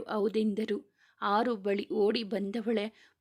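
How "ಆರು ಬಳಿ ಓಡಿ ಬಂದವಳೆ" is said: usual speaking voice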